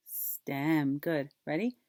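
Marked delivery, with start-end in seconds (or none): none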